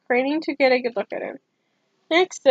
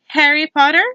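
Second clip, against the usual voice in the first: calling the name; 5 semitones higher, 6 dB louder than usual. A narrator's reading. Name called out at last.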